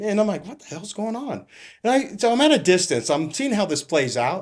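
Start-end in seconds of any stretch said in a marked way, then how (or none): 0.5-1.4 higher, softer puzzled exclamation; mock baffled, pitch lifted; retold bewilderment. Officer wondering at the surrounded car, bewildered.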